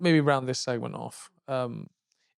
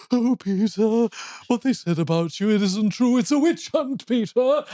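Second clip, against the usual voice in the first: higher, pleading admirer voice; devoted stalker; 6 semitones higher than usual. Retold or imitated. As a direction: reassure him like a besotted fan. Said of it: "on the bottom of the stairs going".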